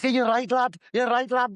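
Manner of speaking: high-pitched mock Scouse; Scouse accent attempt. Co-host demonstrates their lad-heavy imitation, Scouse accent attempt. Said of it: "I just say lad at the end of every sentence".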